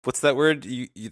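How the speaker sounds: normal talking voice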